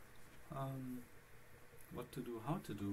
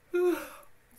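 big, high stretching yawn; loud yawn; 11 dB louder than usual. Real, not acted. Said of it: "Sorry, I'm yawning".

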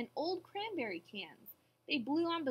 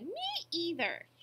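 high, drawn-out joey squeak; young kangaroo; 5 semitones higher, slower than usual. A narrator's reading. Young kangaroo backing mother, high piping voice. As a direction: echo it small and slow. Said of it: "young kangaroo in her pouch said".

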